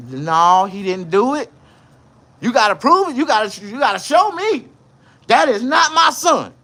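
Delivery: mother loudly denying her son did anything; mama's loud denial. Retold or imitated. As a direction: demand they prove it, as his mother. Mama denying it to police.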